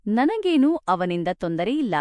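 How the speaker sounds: ordinary delivery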